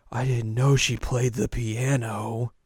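slow, drawn-out jealous remark; mock-hurt, stretched delivery; acted jealousy. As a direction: drag the words out pointedly. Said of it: "be very jealous".

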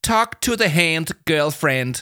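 high, loud sassy put-down in English; emphatic high-pitched brush-off; tight, curt. A narrator's reading. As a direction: shut someone down with drawn-out attitude. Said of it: "sagde Yama stramt".

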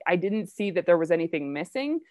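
ordinary delivery

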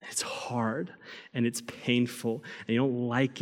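a soft unvoiced whisper. A whispered comfort from God to his struggling people. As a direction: breathe it like a secret comfort. God's tender aside.

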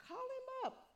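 high, urgent "call him up"; urging call. Real, not acted. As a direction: pastor urging the congregation to call God, high. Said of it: "preacher urging congregation".

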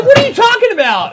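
high, loud shriek of disbelief; mock exasperated girlfriend. Girlfriend's incredulous retort.

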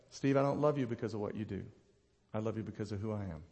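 low, soft, loving retelling; tender reassurance. Retold or imitated. God telling Steve why he loves him, low and tender. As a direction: bring it right down, quiet and sincere. God's loving words retold.

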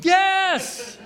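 high, loud jubilant yes; inner cheer. Retold or imitated. Inner cheer at landing the painting job, jubilant yes. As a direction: burst out with a triumphant yes. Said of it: "On the inside".

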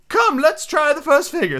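high-pitched, earnest storybook turtle; mock turtle. Mock turtle telling Alice about sea life, earnestly.

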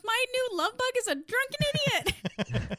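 high mocking sing-song; mocking girly voice. Telling the show's plot, high mocking girlfriend voice. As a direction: pitch it high and mock her. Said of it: "She goes".

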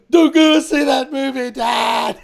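high, loud, slow cry to his dad; hammed-up tearful shout; mock wailing plea. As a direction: overwrought plea through mock sobs. Retelling sobbing to his dad.

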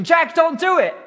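imitated viewer yelling at the screen; mock yell. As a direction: yell at the TV hero to stop, hammed-up. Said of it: "wanting to yell at the screen".

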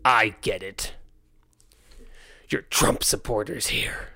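cold, slow, contemptuous sneer; dehumanizing tone. Retold or imitated. Woman accusing skate park owner, contemptuous sneer. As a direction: sneer it like she's judging them. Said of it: "Her tone. Her tone was so dehumanizing".

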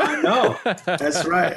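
high-pitched reply through real laughter; laughing agreement. Real, not acted. Guest to host joking about the Holy Ghost, laughing.

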